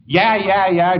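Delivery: imitating a kid kicking off a tantrum; hammed-up angry child; mock child tantrum. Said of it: "He's angry about the whole thing".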